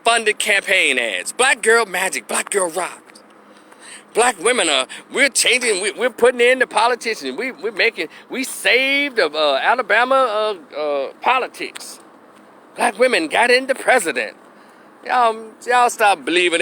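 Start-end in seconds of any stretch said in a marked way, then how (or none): 1.3-3.0 sneering sing-song imitation of the slogans; mock the slogans higher, sneering. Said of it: "All is a bunch of funded". 4.1-14.4 higher mock-proud voice; mocking feminist boast. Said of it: "mimicking feminists".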